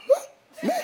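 "What?" leaps way up in a squeal; shocked squeak. Retold or imitated. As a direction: squeal in disbelief. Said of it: "And I did it again".

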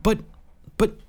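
broken, halting protest; stammering. A narrator's reading. Lloyd protesting Asha's offer of comfort, stammering. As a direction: stumble on the word, pausing between tries. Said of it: "stammered Lloyd".

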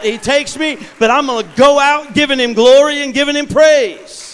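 loud, high, fervent declaration; defiant vow. Retold or imitated. Preacher reliving his vow to praise God. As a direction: proclaim it at full preaching force.

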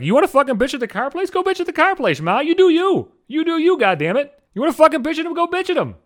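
high-pitched, animated pep talk to Ma; emphatic egging-on. Acted. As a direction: cheer her on in mock exasperation. Acted urging mother to go complain.

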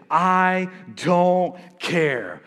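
very slow, drawn-out give-up; surrendering indifference. Acted. Preacher acting out surrender in personal conflict, drawn-out indifference. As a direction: draw out each word as if giving up. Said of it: "throw our hands up and surrender and say".

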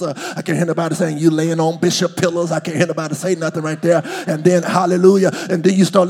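fast, loud, rolling sermon riff; excited pulpit momentum with quick asides; full-force preaching. Real, not acted. Rapid-fire preaching to congregation.

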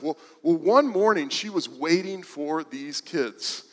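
ordinary talk, nothing marked

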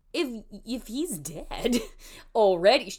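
ordinary talk, nothing marked

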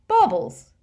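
high, loud and sure of himself; confident, loud. A narrator's reading. Ron giving the fat lady a password, confidently loud. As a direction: announce it boldly, too loud for the listener. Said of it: "said Ron confidently".

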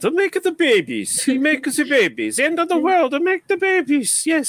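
put-on high, hammy Italian accent. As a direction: ham up a slightly Italian accent. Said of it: "do it in a slightly Italian accent".

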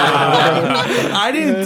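breaks into laughter mid-sentence; laughing. Real, not acted. Laughing while telling friends about the prank. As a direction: laugh through the words.

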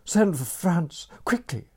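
high, loud urgent commands; ordering. A narrator's reading. Aunt ordering the household, loud and urgent. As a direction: bark orders in a panic. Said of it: "Gerda ordered".

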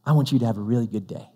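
low, soft, earnest well-wishing; smiling sincerity. Retold or imitated. Clerk sending a customer off, softly sincere. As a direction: wish it gently and sincerely. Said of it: "he has this big smile".

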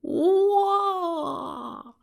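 long, high-pitched swooning whoa; high falsetto cry of admiration; awestruck whoa. Acted. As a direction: stretch it out high, smitten by her looks. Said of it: "He was like".

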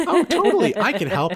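squeaky high, loud eager helper; a chipper alien offering help far too happily; eager Lord. Retold or imitated. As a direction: jump in overeager, way up high. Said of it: "exactly like that. Like".

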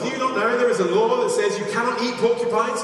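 high-pitched storyteller's reproach; mock admonishing. Villager warning a porcupine hunter, mock admonishing. Repeated warning acted in fable.

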